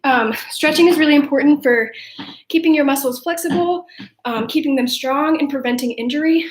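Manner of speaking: normal talking voice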